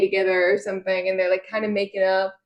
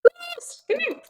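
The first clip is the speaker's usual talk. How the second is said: tiny, extremely high begging voice; mock pleading; 3 dB quieter, slower than usual. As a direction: coax in a put-on squeak. Mocking the begging character.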